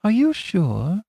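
high, timid, eager question; timid eagerness. Acted. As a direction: ask shyly but keen. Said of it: "with the timid eagerness of the private secretary".

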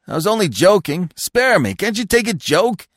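high-pitched, loud, frantic plea; high, desperate backpedalling; frantic protest. A narrator's reading. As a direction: beg off in a high, loud panic. Beaten character protests it was a joke.

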